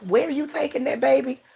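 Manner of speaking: a hint of a disapproving great-grandma asking where the baby's going; great-grandmother's voice. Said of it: "I can hear her saying".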